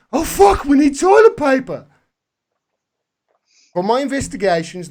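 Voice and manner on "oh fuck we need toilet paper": loud, high-pitched panicked yell; exaggerated shopper's panic; mock panic. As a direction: cry out like an alarmed shopper, hammed up.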